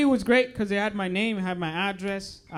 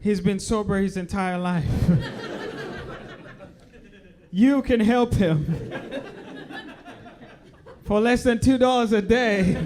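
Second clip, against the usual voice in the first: mock charity appeal, louder; celebrity narrating a charity commercial; 2 semitones higher, 3 dB louder than usual. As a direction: plead like a tearjerker donation ad. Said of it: "unicef commercials".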